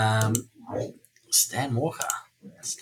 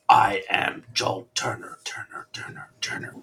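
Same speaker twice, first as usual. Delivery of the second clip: whispered with a fading echo; breathy echo-chamber repeats; echo mic; 5 semitones lower than usual. Retold or imitated. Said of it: "like, echo mic".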